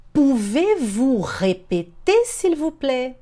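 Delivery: slow, syllable-by-syllable question; slow teaching demo. Shown as a demonstration. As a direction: model the question slowly. Teacher models phrase slowly. (pergunta modelo, bem devagar)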